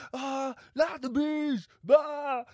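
very high, slow Nicolas Cage shriek; Nicolas Cage scream. Retold or imitated. Cage as bees pour in, shrieking high. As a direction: shriek it in mock horror, drawn out. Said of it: "he's screaming there".

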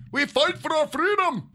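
high, loud, slow Scottish-or-Irish cry; bad Celtic accent. Shown as a demonstration. Streamer showing off accents, a drawn-out hammy Scottish cry. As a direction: bellow it in a badly faked Scottish accent. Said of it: "Is that Irish or Scottish?".

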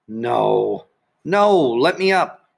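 drawn-out, rising wail of refusal; pleading protest. A narrator's reading. Tired Sheila begging to leave the pool, wailing protest. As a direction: protest slowly, pitch climbing on the second no.

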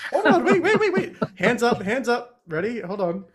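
high, loud, urgent stop call; excited interruption. Real, not acted. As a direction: burst in and halt everyone, voice shooting up. Urgently halts play over a roll.

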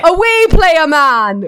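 high, loud mock-angry outburst; imitated gamer frustration. Host imitating the friend losing at fifa, hammed-up frustration. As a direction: whine it loudly like a frustrated gamer. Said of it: "you get frustrated with your pro".

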